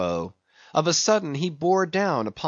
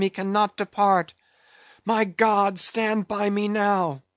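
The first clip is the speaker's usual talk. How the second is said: high, desperate first mate crying out; alarmed sailor praying as doom bears down; anguished Starbuck; 5 semitones higher than usual. Acted. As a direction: shout orders, then plead to heaven in dread. Starbuck's alarmed cries and prayer.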